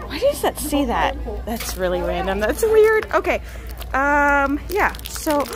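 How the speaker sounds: ordinary delivery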